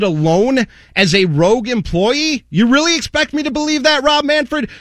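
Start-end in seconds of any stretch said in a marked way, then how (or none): 2.5-4.7 incredulous. Acted. Incredulous rhetorical rant.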